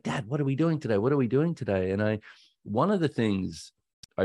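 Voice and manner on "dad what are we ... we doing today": put-on a small boy tugging at dad in the morning; little son asking. Four-year-old son waking his dad, asking brightly in a high voice.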